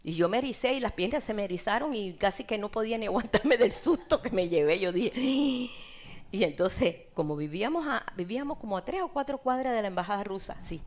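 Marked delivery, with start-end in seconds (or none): 5.2-6.3 sharp startled gasp; retold gasp of fear; frightened gasp. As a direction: catch your breath in fright. Fright when militia stopped her.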